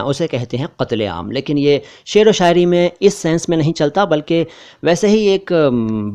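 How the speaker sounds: normal talking voice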